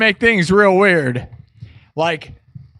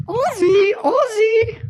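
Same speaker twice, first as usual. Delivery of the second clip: very high, drawn-out calling voice; high falsetto wifely call; Sharon Osbourne impression; much slower than usual. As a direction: call for him in a piping, puppet-like voice. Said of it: "do your best Sharon Osbourne impression".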